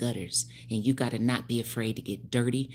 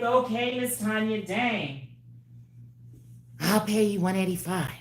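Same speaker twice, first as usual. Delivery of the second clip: mock client voice; 9 semitones higher, 3 dB louder than usual. Acts out her clients a third time.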